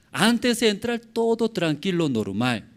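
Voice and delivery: usual speaking voice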